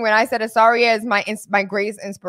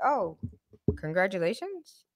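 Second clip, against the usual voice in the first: dry sarcasm; 9 dB quieter than usual. Retold or imitated. Daughter's dry congratulations.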